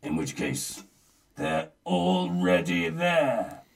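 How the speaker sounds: usual speaking voice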